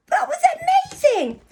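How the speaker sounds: high bright burst of delight; excited praise. Real, not acted. Praise after blending.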